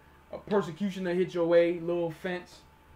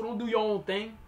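ordinary talk

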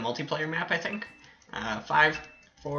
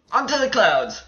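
excited call; 7 semitones higher, 5 dB louder than usual. Real, not acted. Calling out next jump target.

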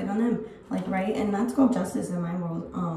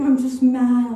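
venting about people who waste her time; real frustrated outburst; 3 semitones higher, 10 dB louder, slower than usual. Real, not acted. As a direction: burst out, much louder. Venting anger.

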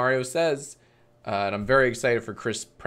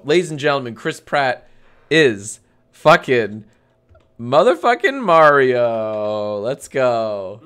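mock hype announcer; 8 dB louder than usual. Streamer announcing Mario casting to the crowd, mock hype announcer. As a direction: build to a booming, stretched-out name.

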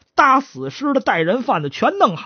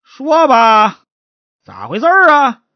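louder, higher demand; stern interrogation; 6 semitones higher, 6 dB louder, slower than usual. Acted. Magistrate questioning the chatterbox, stern and loud. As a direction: press the suspect for an answer.

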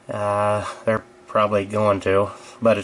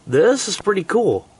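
excited; 9 semitones higher, 3 dB louder than usual. Real, not acted. Excited by heavy snow.

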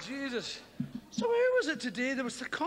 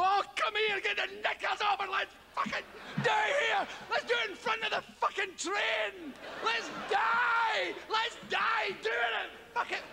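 passionate shout; 8 semitones higher than usual. Retold or imitated. Film lover should shout at his partner in wild passion. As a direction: throw all restraint away. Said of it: "dying for him to go".

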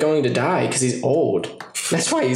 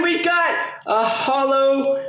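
excited shout; 10 semitones higher, slower than usual. Real, not acted. Final card reveal is a holo.

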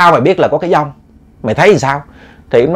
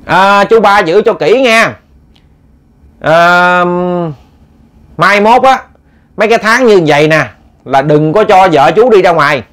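shouting across river; 5 semitones higher, slower than usual. Retold or imitated. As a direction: call out slow to the boat in midstream. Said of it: "Ổng mới la lên". (gọi to với theo ghe, chậm, cao giọng)

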